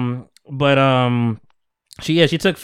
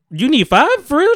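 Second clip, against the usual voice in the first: surprised; 12 semitones higher, 5 dB louder than usual. Retold or imitated. Shocked at five blood vials.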